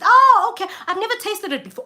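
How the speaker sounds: high, bright fake-interested small talk; fake polite chatter. Guest faking interest in hosts' jollof, voice high. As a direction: feign eager interest to hosts. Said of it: "polite small talk".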